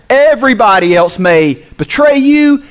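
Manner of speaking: acted-out boastful pledge of loyalty; bold declaration. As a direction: declare it boldly, making a big spectacle. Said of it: "He boldly declared".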